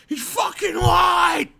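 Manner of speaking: high, slow, shouted mock headline. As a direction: belt it out like a slogan, laughing at it. Mock tweet, shouted for laughs.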